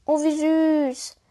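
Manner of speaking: high, slow, delighted coo; cooing girl. Acted. Lena coos high and slow at the robot. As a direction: melt over something cute, drawn out.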